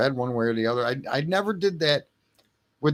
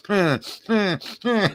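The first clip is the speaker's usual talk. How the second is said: imitated gasping; 6 semitones higher, slower than usual. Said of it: "she'd start going".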